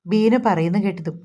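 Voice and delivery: ordinary delivery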